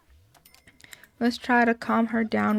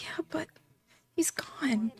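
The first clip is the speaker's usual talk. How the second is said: softer whispered grief; whispered sadness; 4 semitones higher, 12 dB quieter than usual. Acted. Grieving girl to grandma, whispered. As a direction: breathe it out, barely voiced.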